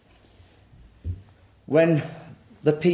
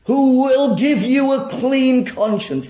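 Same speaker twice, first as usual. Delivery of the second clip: raised, ringing sermon voice; forceful preaching; 11 semitones higher, 10 dB louder, slower than usual. Acted. Preacher pressing the congregation to admit their sin, forceful. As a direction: press the hard truth on the congregation.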